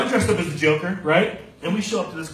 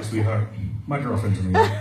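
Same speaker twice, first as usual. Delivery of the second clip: normal talking voice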